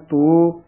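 ਤੋ with a round o; wrong pronunciation. Shown as a demonstration. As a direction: say ਤੋ as plain "to". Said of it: "ਨਾ ਕਹਿਣਾ ਹੈ". (ਤੋ ਹੋੜੇ ਨਾਲ)

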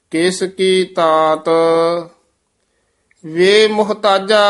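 measured Gurbani reading with drawn-out endings; sustained, chant-like Punjabi scripture reading; scripture recitation. Shown as a demonstration. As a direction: recite phrase by phrase, pausing after each.

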